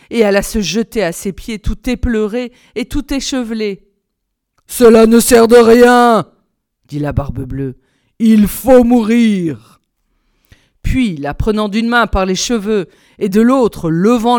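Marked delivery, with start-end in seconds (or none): 4.7-6.3 slow, booming villain; loud, implacable tyrant; merciless shouting. A narrator's reading. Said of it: "se mit à crier si fort". 8.2-9.8 slow, loud death sentence; booming merciless villain; menacing Bluebeard. A narrator's reading. Bluebeard dooms his pleading wife.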